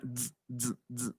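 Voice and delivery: short, soft electric buzzes; quick voiced dz bursts; live-wire dz buzz. Shown as a demonstration. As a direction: imitate a bare wire sparking, "dz, dz". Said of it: "es como un cable pelón".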